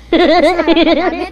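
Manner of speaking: loud laugh; real laughter. Real, not acted.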